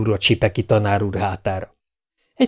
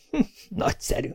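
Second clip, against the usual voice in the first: whispered delight; 13 semitones higher, 9 dB quieter than usual. A narrator's reading. Teacher's delighted aside.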